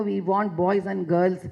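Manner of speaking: ordinary delivery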